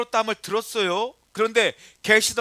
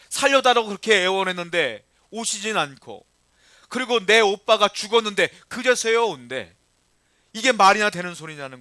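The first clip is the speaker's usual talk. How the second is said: louder, rising indignation; indignant preaching; 5 dB louder than usual. Acted. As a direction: make them feel the injustice. Voicing listeners' indignation. (억울함을 담아 힘주어 설교)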